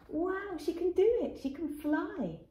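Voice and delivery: high delighted exclaim; excited cheer. Real, not acted. Narrator cheers to children as Robin flies. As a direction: cheer her on. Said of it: "narrator cheering".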